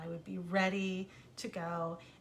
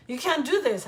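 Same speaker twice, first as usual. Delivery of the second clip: loud, higher indignant cry; angry protest; 6 semitones higher, 10 dB louder than usual. Retold or imitated. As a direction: protest it, outraged. Cameraman protesting angrily.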